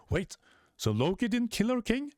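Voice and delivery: high astonished crowd voice; surprised crowd. Acted. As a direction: ask it in disbelief. People reacting in shock.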